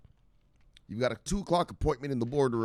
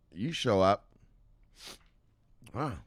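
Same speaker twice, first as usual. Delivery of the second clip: ordinary talk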